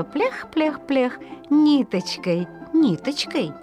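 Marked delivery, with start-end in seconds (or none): none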